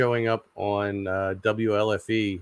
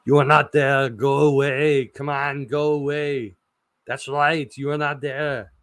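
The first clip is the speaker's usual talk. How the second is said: higher, louder muscle-man movie-star voice, hammed up; Arnold impression; 5 semitones higher, 3 dB louder than usual. Vlogger hamming up an Arnold impression for Kate. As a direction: ham up a tough action-hero line. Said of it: "like Arnold".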